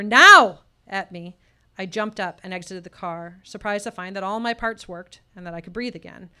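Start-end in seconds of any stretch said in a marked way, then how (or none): 0.0-0.6 sharp, very loud single-word bark; barked order. Retold or imitated. Pastor barking at the stunned teen to move, a loud sharp bark. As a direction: snap it out like a command. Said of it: "he barked another".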